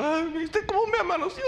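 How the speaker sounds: high, put-on whiny voice with a giggle; mocking a fragile churchgoer. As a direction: play the touchy believer, prissy and hurt.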